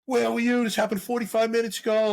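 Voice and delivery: irate homeowner scolding the cop; complaining lady. Retold or imitated. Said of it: "She's like".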